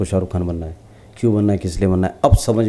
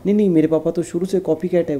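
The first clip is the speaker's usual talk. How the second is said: put-on higher child voice, teasing; child's teasing; 8 semitones higher than usual. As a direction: play the little girl mocking her dad. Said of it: "बोले". (बच्ची की ऊँची, चिढ़ाती आवाज़)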